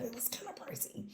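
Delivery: whispered, quick and confidential; whisper aside. Real, not acted. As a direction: let them in on a secret. Aside about the price.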